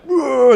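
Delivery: high, loud mock "whoa"; imitated yelp. Acting out the cop running from his brother, mock cry.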